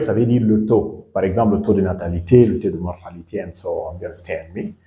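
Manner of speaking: ordinary talk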